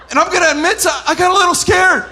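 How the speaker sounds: normal talking voice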